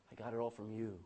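low and soft; humble prayer. Acted. Preacher voicing Jacob humbled before God, low and soft. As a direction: say it humbly, bowed down.

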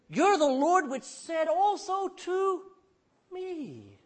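high and loud, slow and reaching; fervent prayer. Acted. Preacher voicing Jacob calling on God, high and slow. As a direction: cry it out to God.

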